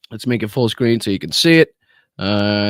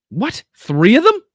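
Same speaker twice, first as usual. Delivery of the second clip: much higher and louder, incredulous; surprised outburst; 11 semitones higher, 6 dB louder than usual. Real, not acted. Streamer to chat, stunned by a third ad, incredulous high yelp. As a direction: yelp in disbelief at another ad.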